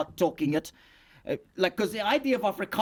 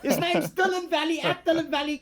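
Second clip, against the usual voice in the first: mock alarm; 10 semitones higher than usual. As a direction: pretend to sound the alarm on him. Puppet mock-panic calling out guest.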